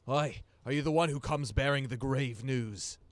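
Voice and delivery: hearty loud greeting. Acted. Helmgut calls out loudly to the visitors bringing grave news. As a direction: hail the newcomers loudly like a gruff old shopkeeper. Old dwarf greets arriving party.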